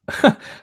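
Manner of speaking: a real breathy laugh breaking through. Real, not acted. Reader laughs at trying to fake Norwegian, breathy laugh. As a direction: crack up at your own fake Norwegian. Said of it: "Oh, I can't even do this".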